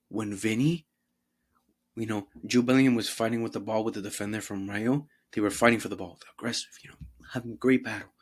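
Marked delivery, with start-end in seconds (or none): 6.4-8.1 whisper. Real, not acted. Whispered aside on the battle.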